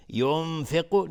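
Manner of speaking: ینفق slow and loud, n held as a nasal hum; ikhfa. Shown as a demonstration. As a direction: hide the n of ینفق in a hum before f. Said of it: "اخفای حقیقی".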